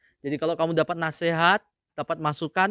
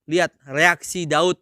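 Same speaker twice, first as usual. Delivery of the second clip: ordinary talk, nothing marked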